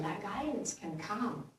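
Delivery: ordinary delivery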